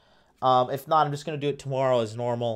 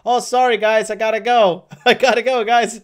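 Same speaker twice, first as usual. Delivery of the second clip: loud, raised-pitch impression of John Shore; imitating a man bailing out loudly; mock hurried goodbye; 9 semitones higher, 9 dB louder than usual. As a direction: call out an apologetic exit, hammed up. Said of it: "Then he comes back to the camera".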